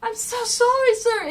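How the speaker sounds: high, frantic apology; panicked Penny One. Retold or imitated. Butler on the intercom reporting disaster, high panicked voice. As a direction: pitch it up, distraught and helpless. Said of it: "Penny One is going on the intercom".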